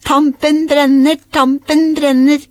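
high, loud, drawn-out game call; calling out together. A narrator's reading. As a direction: sing out the warning, slow and high. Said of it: "ropte både pappa og Aurora".

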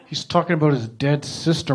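ordinary delivery

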